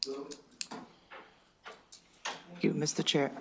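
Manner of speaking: real duck-like quack in the voice. Real, not acted. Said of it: "I'm sorry for my quacking duck voice".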